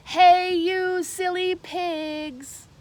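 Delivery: loud, high drawn-out yell at the pigs. A narrator's reading. Megan yells at the pigs, loud and slow. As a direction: shout it out slow at the silly pigs. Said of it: "and yelled".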